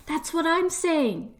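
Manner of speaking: a market seller who finally feels understood; vendor voice. Acted.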